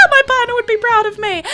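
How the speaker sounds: high, giddy squeal; giddy pride. Real, not acted. Streamer boasting about the lag fix, giddy pride. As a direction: gush it, still high from laughing. Celebrating, still giddy after laughing.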